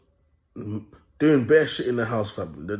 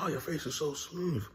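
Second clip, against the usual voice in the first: soft, raised admiring coo; imitated smitten woman; 4 semitones higher, 10 dB quieter than usual. Woman admiring his face, imitated softly and higher. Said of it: "And she's like".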